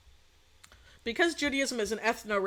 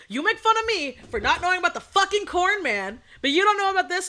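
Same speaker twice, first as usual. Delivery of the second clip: high, loud mock indignation; indignant; 9 semitones higher, 6 dB louder than usual. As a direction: throw the hypocrisy back, acted-out outrage. Neighbor's retold indignant comeback.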